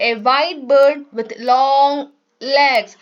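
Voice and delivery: slow, careful textbook reading; reading aloud. Shown as a demonstration. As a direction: read each word out slowly for the class. Teacher reads textbook English slowly.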